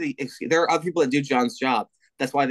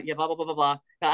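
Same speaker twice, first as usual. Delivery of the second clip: ordinary delivery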